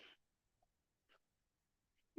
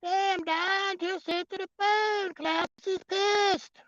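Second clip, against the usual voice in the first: much higher, held strained voice; put-on tannoy call; mock announcer; 4 dB louder, slower than usual. Mock phone line announcement, high strained voice.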